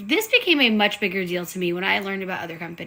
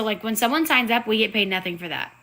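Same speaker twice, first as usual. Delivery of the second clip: ordinary delivery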